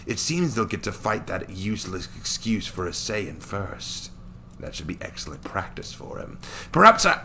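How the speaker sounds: a cruel Saiyan amused by his prey; sneering villain. Acted. Daikon plotting aloud.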